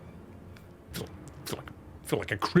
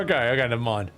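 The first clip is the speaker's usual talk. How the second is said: normal talking voice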